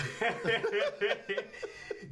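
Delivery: high, loud laugh; real, high-pitched cackling laughter. Real, not acted. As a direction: burst out laughing. Laughing at a viewer's comment.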